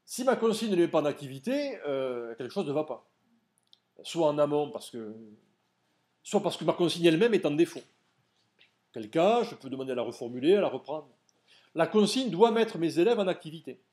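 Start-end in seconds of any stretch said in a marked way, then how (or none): none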